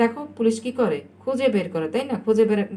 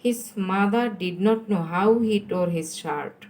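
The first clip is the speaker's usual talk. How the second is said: stretched, deliberate model sentence; slow dictation; much slower than usual. Shown as a demonstration. Teacher reads the exercise sentence to pupils, very slowly. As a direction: read it out very slowly.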